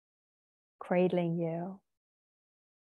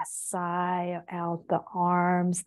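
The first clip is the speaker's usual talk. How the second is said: normal talking voice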